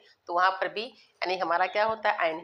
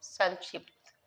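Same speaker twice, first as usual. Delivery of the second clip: ordinary delivery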